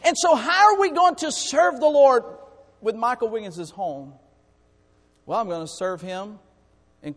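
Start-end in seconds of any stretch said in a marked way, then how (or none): 0.0-4.1 high, loud impassioned preaching; preaching at full force. Real, not acted. Pastor to congregation, loud high fervent preaching. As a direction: lift the voice and preach hard.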